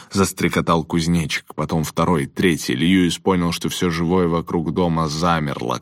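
plain reading voice

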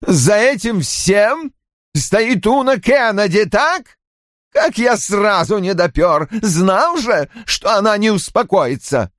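high-pitched, indignant accusation; angry rant. A narrator's reading. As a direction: rail as if seeing through a plot. Roy keeps ranting after yelling. (гневная, возмущённая тирада)

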